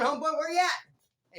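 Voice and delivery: mock dazed call. Dazed fighter after punch.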